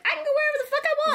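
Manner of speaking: very high, fast, squeaky mock boast; mock Elizabeth. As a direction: rattle it off way up high like a cartoon girl. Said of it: "was like".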